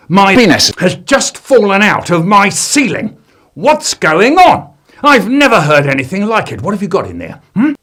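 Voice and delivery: loud furious shout. Acted. Downstairs man shouting at boy, loud furious. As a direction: bellow in outrage. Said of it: "he shouted".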